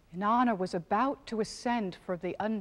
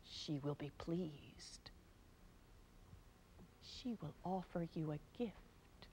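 whispered; 4 semitones lower, 7 dB quieter than usual. A narrator's reading. God's secret instructions.

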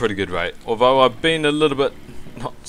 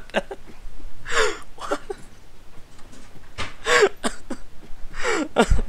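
long high airless wheeze; can't stop laughing; long wheezing laugh. Real, not acted. Laughing fit.

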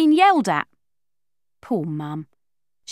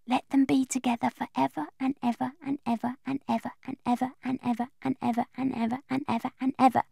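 rushed prayer; 3 semitones higher, 8 dB quieter, faster than usual. Acted. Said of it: "saying my prayer".

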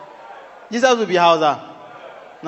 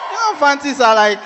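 shouted aside; 9 semitones higher, 4 dB louder than usual. Real, not acted. Hyped crowd call.

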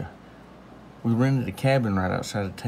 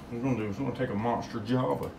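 normal talking voice

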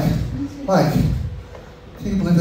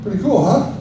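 ordinary delivery

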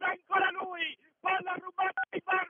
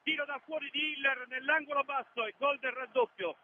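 ordinary talk, nothing marked

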